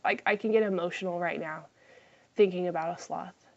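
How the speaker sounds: ordinary delivery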